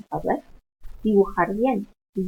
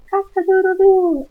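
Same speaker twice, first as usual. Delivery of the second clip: high, loud "cock-a-doodle-doo" crowing; rooster crow; 12 semitones higher, 9 dB louder than usual. Shown as a demonstration. Teacher demonstrates the English rooster call, crowing high and loud. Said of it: "does the rooster say".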